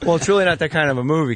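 ordinary delivery

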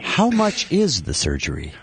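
mock-suave, drawn out and low; imitating the doctor's voice. Shown as a demonstration. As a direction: try out a slow post-surgery delivery. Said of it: "should I start talking like this".

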